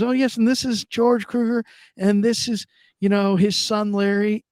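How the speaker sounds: imitating a nurse in a high sing-out voice; introducing nurse. Nurse introduces patient and son, introducing nurse. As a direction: make introductions pitched well up.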